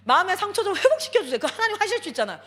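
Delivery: loud, pleading prayer that turns into a demand; arguing with God. Retold or imitated. Preacher retelling her prayer for a troubled boy, loud and demanding. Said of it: "막 따져가기도 하고".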